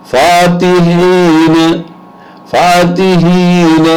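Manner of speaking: فاتحین with a long drawn-out فا, the rest normal; lengthened first vowel. Shown as a demonstration. Teacher demonstrating madd to Quran students, slow and stretched. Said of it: "فا مدد کے ساتھ آ رہا ہے".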